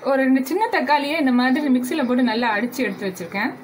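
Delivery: ordinary talk, nothing marked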